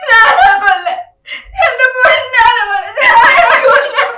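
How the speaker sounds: loud, high wailing sob; wailing grief. Acted. Mother wailing over her dead daughter, sobbing loudly. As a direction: cry out her loss at full voice.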